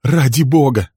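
urgent woman's entreaty; pleading. A narrator's reading. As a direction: beg him desperately, pitch lifted. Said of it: "умоляла его Берил".

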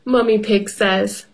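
ordinary delivery

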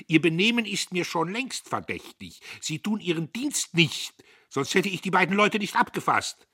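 high, loud accusations; triumphant angry shouting; unrestrained anger. A narrator's reading. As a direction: let loose at the foreman. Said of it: "war nicht länger zu halten".

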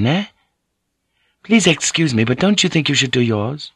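put-on high, accented woman's voice; insistent, formal lady instructing him; earnest Japanese lady. Voices Mariko in the dialogue.

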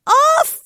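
loud, very high yelp; startled cry. A narrator's reading. Dory jolts awake, shrieking high and loud. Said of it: "وصرخت قائلة".

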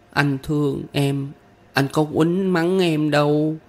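put-on high child voice, drawn-out opening; small child's earnest high voice; little girl pupil. A narrator's reading. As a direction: voice a young girl, pitched well up. Child pupil answering her older friend.

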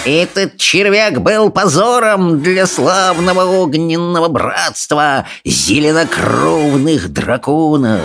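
high, slow, drawn-out sneer; snarling dragon. A narrator's reading.

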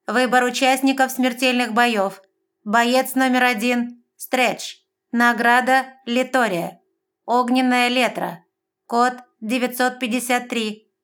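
high, loud, slow machine announcement; electronic announcer. A narrator's reading. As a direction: announce it like an arena computer. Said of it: "Электронный голос громко произнёс".

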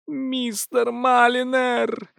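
high, long-drawn imploring call; lady's high pleading cry; pleading wail. A narrator's reading. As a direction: plead through a shut door, stretching each word. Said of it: "просочился умоляющий голос леди Бассет".

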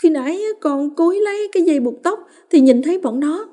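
put-on high child's voice, breaking into a cry; sobbing. A narrator's reading. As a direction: plead through tears, breaking down. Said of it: "trong tiếng nấc". (con Hoa vừa nấc vừa kể với mẹ)

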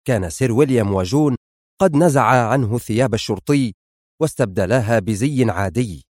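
ordinary delivery

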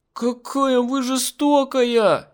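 high, loud, very slow accusation; child's wail. A narrator's reading. Girl howls at the woman, high loud and slow. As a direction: drag out the blame like a sulky kid. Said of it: "завопила девочка".

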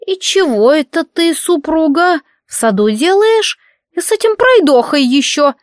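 put-on high, suspicious old woman voice; old hag. A narrator's reading. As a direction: needle them like a nosy crone.

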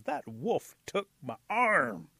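slow, loud, drawn-out complaint; indignant Rabbit. A narrator's reading. Rabbit with one arm glares at council house, slow indignation. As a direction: stretch every word in outrage.

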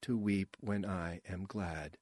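soft, slow, wooden recital; monotone line reading. A narrator's reading. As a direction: deliver it softly on one repeated note. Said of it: "like someone hitting the same note on a keyboard".

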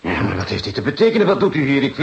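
loud and rising, demanding an answer; indignant outburst. Acted. Visitor confronting police in his dead lover's flat, indignant and loud. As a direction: confront the intruders, voice climbing.

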